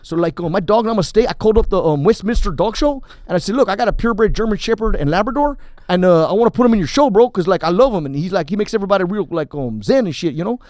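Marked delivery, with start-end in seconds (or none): 3.5-10.6 lightly voiced East LA bro, louder; a hint of the spiritual gangster pitching his dog; prank call gangster. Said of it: "East L A gangster gone good".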